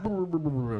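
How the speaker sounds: quick rolled brr, imitating the doctor at work; rippling run of brrs; imitated brr noise. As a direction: trill a short mock motor noise. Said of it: "like that, and she was out".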